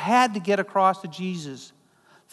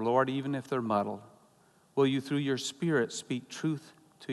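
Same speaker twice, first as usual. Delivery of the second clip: softer, hushed pastoral prayer; quiet prayer; 6 semitones lower, 6 dB quieter than usual. Acted. Pastor praying over his people, hushed and reverent. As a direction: pray gently before the congregation.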